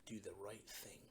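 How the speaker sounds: usual speaking voice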